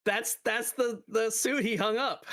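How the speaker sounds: laughing. Real, not acted. Laughing at the suit.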